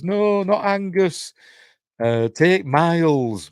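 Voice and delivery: high, drawn-out mock pleading; retold mock plea. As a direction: plead slowly and loudly, hammed up in retelling. Retold nightmare plea to the mermaid.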